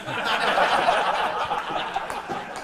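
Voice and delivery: a loud, breathy laugh at his own joke; hearty laugh. Real, not acted.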